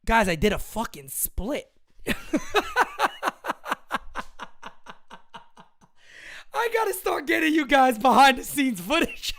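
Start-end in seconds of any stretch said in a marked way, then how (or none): 2.0-4.9 high breathless wheezing laugh; wheezy high laugh. Real, not acted. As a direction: lose it in a wheezy, airless giggle. Laughing after doing a split.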